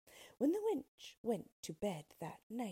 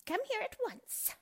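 shouting witch. Acted. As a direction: bark it out as the wicked witch. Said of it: "she shouted at Dorothy".

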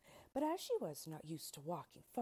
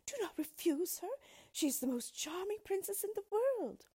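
high, louder pleading queen; distressed plea; 5 dB louder than usual. A narrator's reading. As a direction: implore him, theatrically anxious. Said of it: "said the queen in great distress".

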